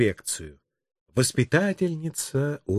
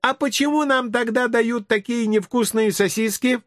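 put-on high, whiny-kid question; inquisitive child; 9 semitones higher than usual. Child complaining to the policeman about sausages, high kid voice. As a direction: pipe it high like a small child.

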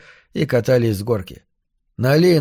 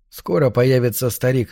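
ordinary delivery

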